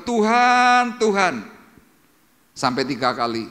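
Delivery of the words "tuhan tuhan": high, loud cry of "Tuhan, Tuhan"; anguished calling out to God; desperate plea. Retold or imitated. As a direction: beg God like Paul in pain. Paul crying out to God.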